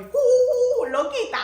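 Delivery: very high loud whoop, laughing; crazy Spanish self. Real, not acted. Showing her lively Spanish personality, loud high whoop. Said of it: "en español soy".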